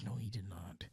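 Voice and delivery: whispering in embarrassed disbelief. Retold or imitated. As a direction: breathe it out in disbelief. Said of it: "I was like".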